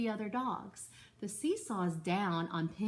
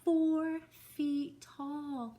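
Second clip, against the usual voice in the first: slow, drawn-out and higher; storybook emphasis; 7 semitones higher, 4 dB louder, much slower than usual. Acted. Teacher reading to second graders, slow and sing-song. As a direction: stretch it out for the children. Reading a picture book to class.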